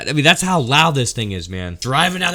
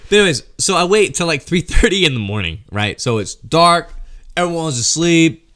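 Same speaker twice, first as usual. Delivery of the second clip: ordinary talk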